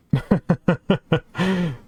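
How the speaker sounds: high laugh at a lucky miss; short laugh. Real, not acted. Streamer laughing at his lucky game, real laugh. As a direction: laugh with relief.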